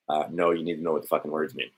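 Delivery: ordinary talk, nothing marked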